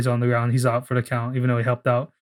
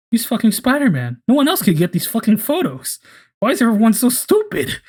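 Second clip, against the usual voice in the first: much higher, frustrated rant; exasperated outburst; 9 semitones higher, 3 dB louder than usual. Retold or imitated. MJ fed up that nobody sees it, high frustrated rant. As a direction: vent at how dumb everyone is.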